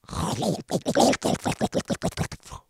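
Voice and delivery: long rattling, bubbling snore; imitated snoring. Showing how roommate snored every three seconds, snoring. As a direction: imitate a snore that gurgles like a bong. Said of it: "That's how he sounded like".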